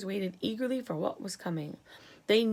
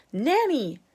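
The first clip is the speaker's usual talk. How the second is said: louder, higher exclamation; exasperated cry; 5 semitones higher, 7 dB louder than usual. A narrator's reading. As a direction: cry out in frustration. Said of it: "cried the president".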